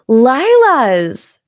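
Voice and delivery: loud, drawn-out exasperated word; imitated exasperation; frustrated repeat. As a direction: say it as if it should be obvious. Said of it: "getting so frustrated".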